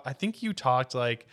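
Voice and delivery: ordinary talk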